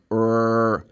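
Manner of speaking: "R" as a long, curled rrr; American R. Shown as a demonstration. Teacher imitates the American way for contrast, strong R. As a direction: hold a strong r sound on "R". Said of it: "like American way".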